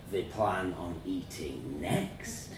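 slow, low teasing question. Acted. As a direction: tease slowly, dragging out the question. Child narrator quotes father's teasing question.

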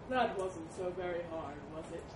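low, condescending elephant; smug elephant. Acted. Elephant releasing the mouse, low and smug. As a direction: murmur it with smug satisfaction.

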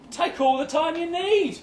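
high-pitched, over-accommodating reply; high, forced reassurance; putting on brave face. Acted. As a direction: pitch it up, trying to sound fine about it. Acted reply to a stalled proposal.

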